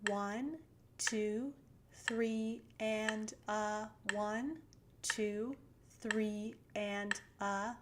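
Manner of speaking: slow, evenly spaced count; counting quarter-note triplets. Shown as a demonstration. Piano teacher counting triplets slowly for students. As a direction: count each number with a pause after it. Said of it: "That would be counted like this".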